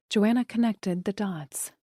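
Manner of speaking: ordinary delivery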